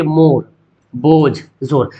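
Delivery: मोर, बोझ, जोर with a round "o", not "oo"; rounded "o" vowel. Shown as a demonstration. Teacher giving examples of the unstretched vowel, मोर with round "o". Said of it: "जिसको खींच कर ना पढ़ा जाए".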